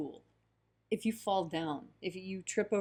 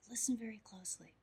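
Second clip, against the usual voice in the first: whispered, leaning in close; whispered, drawing the listener in; 2 semitones higher, 10 dB quieter, faster than usual. A narrator's reading. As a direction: drop to a hushed storyteller's whisper. Story, whispered repeat.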